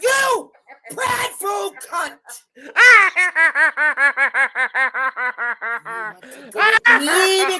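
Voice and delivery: 3 challenge them, yelling; 1 angry shout. Real, not acted. 4 player picking a fight with Angela, angry shout.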